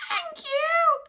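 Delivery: very high squeak; squeaky mice. Acted. The mice thank George, high squeaks. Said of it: "they squeaked".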